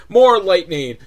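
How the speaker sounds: ordinary talk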